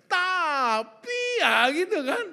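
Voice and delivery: loud, high, stretched-out objection; hammed-up, drawn-out mock protest; defensive mind. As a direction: belt out a protesting "but". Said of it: "pikiran membela".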